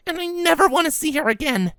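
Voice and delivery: usual speaking voice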